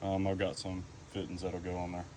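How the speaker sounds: normal talking voice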